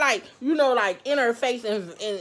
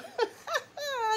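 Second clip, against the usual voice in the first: cute little laugh; 14 semitones higher, 7 dB quieter than usual. Real, not acted. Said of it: "a cute little".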